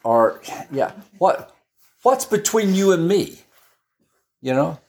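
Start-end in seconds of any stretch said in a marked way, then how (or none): 1.2-3.4 higher, pushy taunt; a demon picking a fight; challenging spirit. Acted. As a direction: square up to him.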